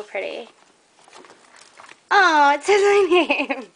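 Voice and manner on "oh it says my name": higher, laughing through the words; delighted laugh. Real, not acted. Crafter finding her name on a gift, delighted laugh. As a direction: break into a happy laugh.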